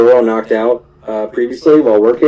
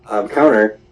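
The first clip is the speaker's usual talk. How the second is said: ordinary talk, nothing marked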